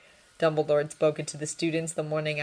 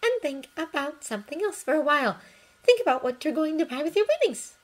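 gentle coaxing; 10 semitones higher, 3 dB louder than usual. Acted. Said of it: "she said gently".

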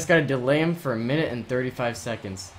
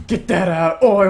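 loud, higher gamer outburst; urgent exclamation; 7 semitones higher, 10 dB louder than usual. Real, not acted. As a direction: call out urgently at a near goal. Urgent reaction to near goal.

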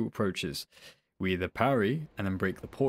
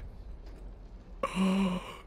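breathy gasp; 10 semitones higher, 5 dB quieter than usual. Real, not acted. Shocked by the boss.

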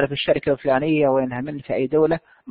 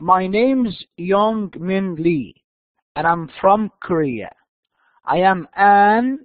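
reading aloud slowly; much slower than usual. Shown as a demonstration. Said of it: "خلوني أقرأ".

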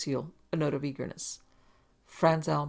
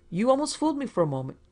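high, fast mocking jab; 8 semitones higher, 6 dB louder, faster than usual. A narrator's reading. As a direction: sneer it out quickly. Franzel mocking realization.

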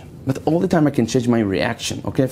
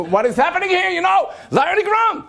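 policeman shouting. Retold or imitated. Policeman storming the restaurant, high shouted orders. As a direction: shout commands like a panicked cop.

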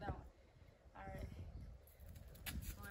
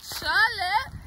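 calling out; 6 semitones higher, much slower than usual. Real, not acted. Calling out to someone on beach.

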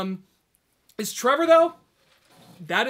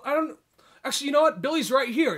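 higher, falsely offhand; mock-casual Trevor; 7 semitones higher, 4 dB louder than usual. Trevor taunting the bikers, higher mock-casual tone. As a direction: toy with them before the reveal. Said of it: "He says".

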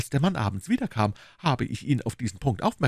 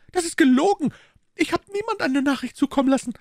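very high, fast, aggrieved maid; indignant maid; 5 dB louder, faster than usual. Acted. Maid complaining to her mistress, very high and fast. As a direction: rattle off the complaint, put upon.

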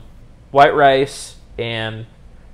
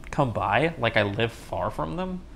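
usual speaking voice

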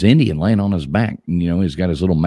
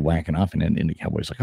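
usual speaking voice

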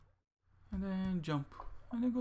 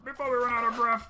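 faster and louder, urgent; 4 semitones higher, 8 dB louder, faster than usual. Real, not acted. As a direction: rush it out, panicking. Urgent repeat.

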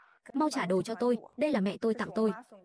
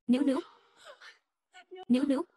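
calling out; 5 semitones higher, faster than usual. Acted. Calling out to daughter.

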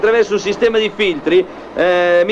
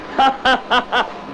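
laughing; 3 semitones higher, 3 dB quieter than usual. Real, not acted. Laughs at his own pun.

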